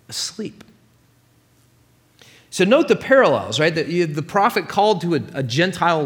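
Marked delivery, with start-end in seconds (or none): 0.1-0.6 soft, near-whispered word; hushed. Real, not acted. Preacher revealing Jonah down in the boat, hushed. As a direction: drop to a whisper for effect. Contrasts sleeping Jonah with the storm.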